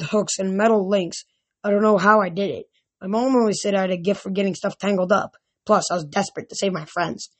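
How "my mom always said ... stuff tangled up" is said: ordinary delivery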